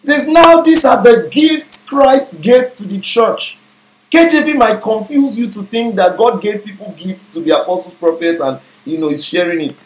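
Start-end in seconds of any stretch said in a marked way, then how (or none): 0.3-3.6 slow, raised reading of the verse; scripture reading. Acted. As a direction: read it slowly, a bit higher and firmer. Reads corrected verse.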